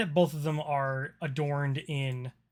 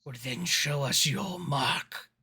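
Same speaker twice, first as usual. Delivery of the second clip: slow, lightly voiced hobgoblin; a hint of a sly hobgoblin drawling; gravelly sly hobgoblin; slower than usual. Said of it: "The, uh, hobgoblin says".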